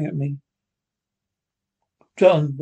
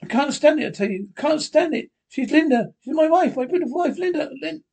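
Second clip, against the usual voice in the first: hysterical; 10 semitones higher than usual. Acted. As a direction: cry out that you can't bear it. John breaks down at the bedside.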